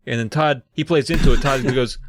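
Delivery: ordinary talk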